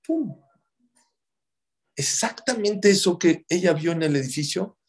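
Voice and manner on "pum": sudden high "pum" of a crack bursting; sharp mock burst; burst sound. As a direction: pop it out like the wall giving way. Wall bursting, sound effect.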